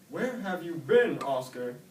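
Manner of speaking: dad greeting Oscar back home; worried snail dad. A narrator's reading. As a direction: call out to the lost son.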